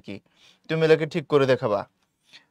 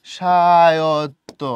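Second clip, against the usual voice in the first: স্বায়ত্ত stretched very slowly and louder; drawn-out dictation; 6 dB louder, much slower than usual. Shown as a demonstration. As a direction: draw out each syllable of স্বায়ত্ত as if writing it. Spells out word while writing.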